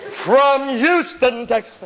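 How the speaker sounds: very drawn-out stadium introduction; marching band announcer. Retold or imitated. Announcer naming the band's hometown at a festival, grandiose. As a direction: stretch the city grandly, then drop. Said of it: "standing there and they go".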